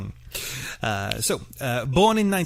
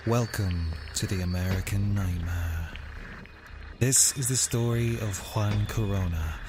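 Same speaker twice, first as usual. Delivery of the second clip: hushed documentary voice opening a murder story; dramatic podcast intro; 4 semitones lower than usual. Acted. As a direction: read it like a true-crime trailer. Dramatic true-crime intro read.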